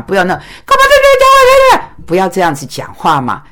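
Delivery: imitating a voice jumping up high; a lightly voiced high-pitched complaint; mock high-pitched shrill talk. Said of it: "你就高八度哗啦哗啦".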